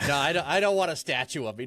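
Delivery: usual speaking voice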